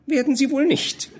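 hoch, mit Lachen gesprochen; lachend spöttisch. Acted. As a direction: kichernde Anzüglichkeit. Wirtin spöttelt über Fräulein. (die Wirtin lästert kichernd über das Fräulein)

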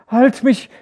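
high loud desperate scream. A narrator's reading. Feverish wife screams to be held, loud. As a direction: scream for someone to hold you. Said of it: "schrie sie".

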